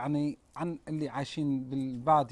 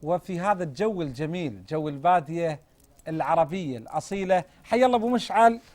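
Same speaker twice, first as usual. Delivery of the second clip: bright, raised presenter voice welcoming viewers; TV host intro; 3 semitones higher, 3 dB louder than usual. Acted. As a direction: open the show like a TV host. Host opens the TV episode. (مقدم برنامج تلفزيوني يرحب بالمشاهدين)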